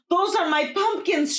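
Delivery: high, loud claim; indignant cry. Acted. Old Juana claiming her pumpkins before the crowd, high cry. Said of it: "she cried".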